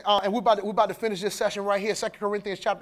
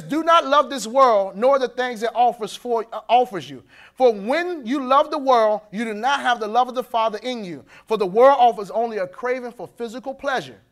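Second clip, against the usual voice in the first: scripture reading; 5 dB louder than usual. Acted.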